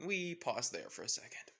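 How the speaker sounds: plain reading voice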